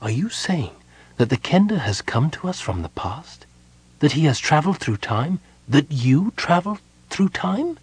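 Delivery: breathy whisper rising to disbelief; hushed disbelief. A narrator's reading. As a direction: whisper it, growing astonished. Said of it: "She drew in a breath".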